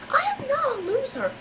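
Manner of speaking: high-pitched, affronted denial; indignant protest. Acted. Peanut gallery member rejects the loser label, indignant.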